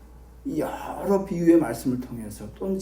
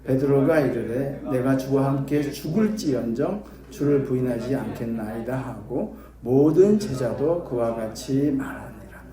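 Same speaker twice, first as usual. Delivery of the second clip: ordinary delivery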